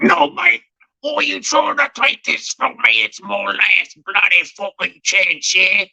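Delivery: very high-pitched put-on British rant; mock angry British. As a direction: blow up in a squeaky British accent. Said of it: "blows up at him or talks like a normal British guy".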